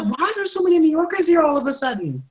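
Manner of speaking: high-pitched mock bewilderment; baffled. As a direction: retell it as a high, puzzled whine. Retold baffled question to newcomers.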